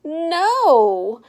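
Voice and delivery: a loud, flat refusal; stubborn loud no. Acted. Stephanie refusing her mom's ponytail offer, loud and stubborn. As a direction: plant your feet and refuse.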